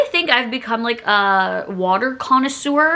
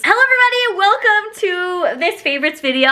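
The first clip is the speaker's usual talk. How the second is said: bright high sing-song hello; high greeting; 8 semitones higher than usual. Acted. Greeting her viewers in a high sing-song voice. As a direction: pitch the intro way up like an announcer.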